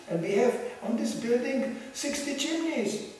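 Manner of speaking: high, hammed-up begging for money; mock pleading pitch. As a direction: play a desperate fundraiser luring people in. Acts out desperate fundraising for building upkeep.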